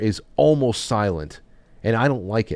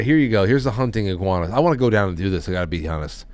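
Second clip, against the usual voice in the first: ordinary talk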